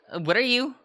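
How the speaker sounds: high startled question; surprised. Acted. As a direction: caught off guard, voice jumps up.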